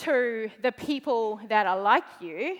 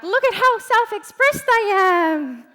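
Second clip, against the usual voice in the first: higher, louder show-off voice; showy boast; 7 semitones higher, 6 dB louder than usual. Retold or imitated. Posting in Facebook challenge groups, loud showy boast. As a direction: brag to the whole group. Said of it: "and you're like".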